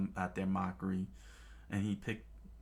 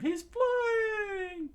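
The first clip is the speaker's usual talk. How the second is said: high, loud, amazed cry; crew's scream; 4 dB louder than usual. Acted. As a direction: shout up at a flying foe. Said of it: "the chef's crew screamed".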